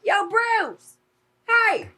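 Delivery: high, loud calling-out voice. Retold or imitated. Kelly calling out to the waiter, loud and high. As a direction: holler across the restaurant at the waiter. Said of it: "she's like".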